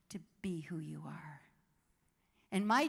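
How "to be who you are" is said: hushed, intimate close; soft whisper. Real, not acted. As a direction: let it drop to a whisper. Softened key point.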